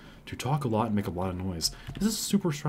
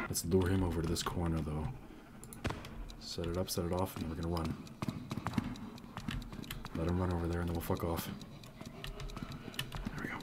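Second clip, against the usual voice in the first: softer, hushed plan; quiet planning; 4 semitones lower, 9 dB quieter than usual. Real, not acted. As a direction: keep it calm and low. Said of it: "I should keep it quiet, keep it calm".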